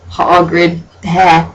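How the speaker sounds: ordinary talk, nothing marked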